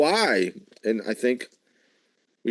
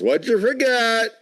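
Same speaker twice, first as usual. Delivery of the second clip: much higher, slow mock wife call; imitating wife; 12 semitones higher than usual. Husband mimicking his wife calling from house, high and slow. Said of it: "my wife always yells".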